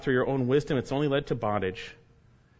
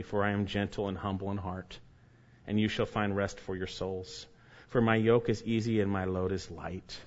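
scripture reading; 4 semitones lower than usual. Acted. Preacher reading the verse to the congregation, solemn and low. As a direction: read the words of Jesus gently and low. Reads scripture passage slowly.

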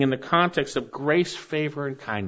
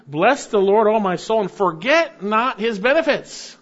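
rising proclamation; 8 semitones higher, 4 dB louder than usual. Real, not acted. Pastor proclaims psalm to congregation.

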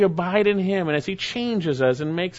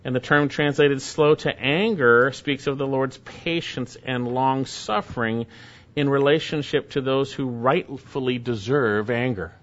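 ordinary delivery

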